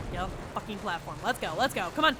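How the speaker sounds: ordinary talk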